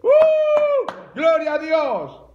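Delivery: high, loud preacher's whoop into praise; exultant whoop. Real, not acted. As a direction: shout praise at full voice. Preacher whoops after demonstrated laugh.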